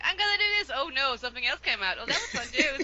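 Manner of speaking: high and bubbly, ending in a laugh; playful self-quote. Retold or imitated. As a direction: act out your own giddy inner voice. Acts out her own accent surprises.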